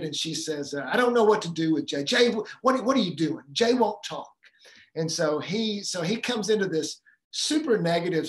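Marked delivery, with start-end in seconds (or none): 0.9-4.3 louder, rushing at the start, then higher and pleading; a worn-out mother at her wit's end; exasperated mom. Retold or imitated. Mother tells husband she can't handle their son, louder exasperated plea. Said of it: "the mom's exasperated".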